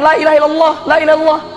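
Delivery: high, chattering parrot. Retold or imitated. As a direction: rattle it off like a parrot. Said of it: "Beo itu ngoceh aja".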